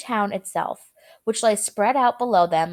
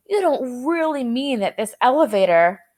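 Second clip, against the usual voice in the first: alarmed protest; 3 semitones higher, 4 dB louder than usual. A narrator's reading. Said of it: "shouted Grandpa Joe".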